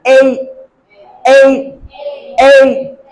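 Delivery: ए gliding from e into ee; teacher modelling ए as an e-ee glide; diphthong. Shown as a demonstration. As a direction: join two sounds within ए. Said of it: "एक ए का sound है और एक ई".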